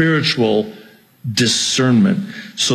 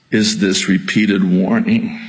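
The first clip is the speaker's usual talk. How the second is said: normal talking voice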